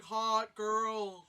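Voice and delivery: playful imitation of a smitten dude; mock smitten guy. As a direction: mimic a guy wowed by a girl.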